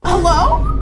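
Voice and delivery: high, loud and slow, stretching each insult out; outraged incredulous rant. Real, not acted. Telling the camera about the old man, loud outraged disbelief. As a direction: spell out his flaws in loud disbelief.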